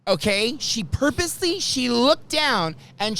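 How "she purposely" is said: ordinary delivery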